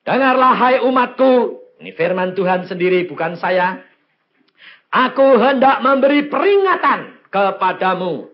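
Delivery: loud, high exhortation; full-force preaching. Real, not acted. Preacher urging believers to endure testing, full-force preaching. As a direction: urge the congregation at full volume.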